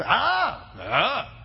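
short mock war yell, first one high; battle scream. Shown as a demonstration. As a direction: let out two brief demonstrative battle cries. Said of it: "and just scream and yell".

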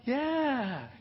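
high, soft, unconvinced; small high voice, humoring her; half-hearted agreement. Retold or imitated. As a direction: imitate a husband feebly playing along. Imitates man's lukewarm agreement.

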